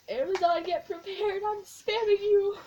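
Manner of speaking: high, loud mock warning; playful threat. Fan warning Amazon of her orders, loud playful threat. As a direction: call out to Amazon, teasing them hard.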